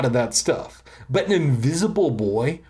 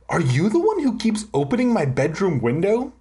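quick, higher-pitched accusing question; demanding; 6 semitones higher, faster than usual. A narrator's reading. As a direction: press for an answer, rushing it. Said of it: "I demanded".

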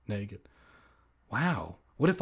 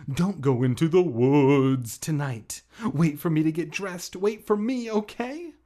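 put-on louder, higher girl's voice urging; pleading girl; 4 semitones higher, 6 dB louder than usual. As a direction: urge him to wait, as Hannah. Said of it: "I heard her call down".

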